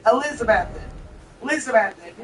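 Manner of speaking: Elizabethton with the last T flowing off; local pronunciation. Shown as a demonstration. Agreeing on how locals say it, local pronunciation. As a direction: repeat Elizabethton, swallowing the final T. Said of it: "let that T flow off".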